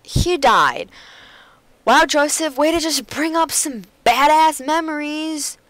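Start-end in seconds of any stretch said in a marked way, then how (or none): none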